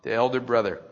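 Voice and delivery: normal talking voice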